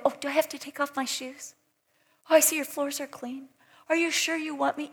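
a guest worried about getting it wrong; fussy nervous guest. Retold or imitated. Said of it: "people don't come in and go".

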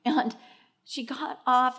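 ordinary talk

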